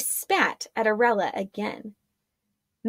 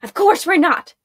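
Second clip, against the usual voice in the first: higher, louder contemptuous retort; spat; 8 semitones higher, 6 dB louder than usual. Acted. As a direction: snap back in scorn. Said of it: "the redhead spat".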